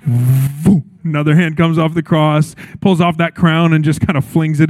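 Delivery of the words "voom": low, punchy "voom" whoosh; imitated whoosh. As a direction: superhero sound effect. Mimics second hand tearing off.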